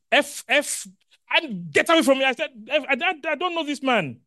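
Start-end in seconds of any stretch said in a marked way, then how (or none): 0.1-2.2 high, loud angry curse; cursing denial. Retold or imitated. Peter cursing at the accusers in denial, high and loud. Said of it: "he said it with cursing". 2.7-4.2 high, loud angry curse; cursing denial. Retold or imitated. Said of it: "That's how he said it".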